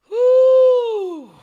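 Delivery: high, loud whoo; sudden high shouted whoo; loud whoop. Real, not acted. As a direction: let out a big whoop. Reacting to Jeremy Renner.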